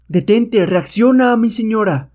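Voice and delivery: high, loud pleading; a servant begging his lady to come to her senses; urgent plea. Acted. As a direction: cry out to snap her out of it.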